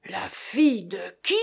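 stunned howl, held back in volume; howled question. A narrator's reading. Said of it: "hurla Chéri Bibi". (hurlement stupéfait, contenu)

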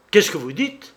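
captain crying out in surprise; astonished exclamation. A narrator's reading. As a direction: burst out in disbelief, rising at each end. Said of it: "s'écria le capitaine Belval".

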